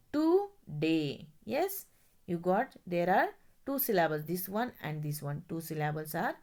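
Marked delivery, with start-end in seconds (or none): 0.1-1.3 slow syllable split. Shown as a demonstration. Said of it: "break it before D".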